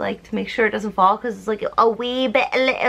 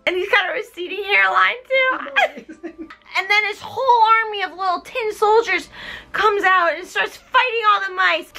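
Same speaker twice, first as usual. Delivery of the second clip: ordinary talk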